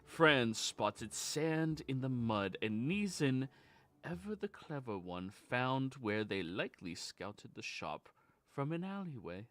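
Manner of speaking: storyteller recounting a tale, measured and grand; grand rhythmic bard. Acted. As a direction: tell it like a bard by the fire. Bard recap of the session.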